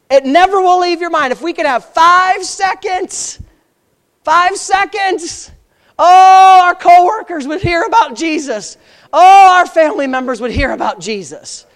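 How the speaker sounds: high, loud, stretched-out exhortation; fervent pulpit outcry; impassioned preaching. Real, not acted. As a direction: cry it out to the congregation, drawing out key words. Preacher at full emotional pitch.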